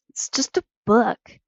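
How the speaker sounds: acted sullen reply. A narrator's reading. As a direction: grumble it a little sullenly. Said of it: "sounding sullen".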